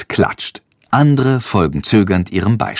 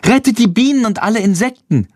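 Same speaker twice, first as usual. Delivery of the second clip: calling out slogan; 10 semitones higher than usual. A narrator's reading. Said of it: "ruft Lilli".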